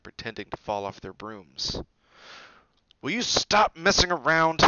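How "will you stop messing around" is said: loud, high exasperated yell; shouted in exasperation; angry yell. A narrator's reading. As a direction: yell at them to cut it out. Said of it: "he yelled".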